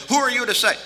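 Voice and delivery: high, loud imitated challenge; indignant critic. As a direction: puff your chest out and challenge the speaker. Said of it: "puff their chest out and they, they might start criticizing".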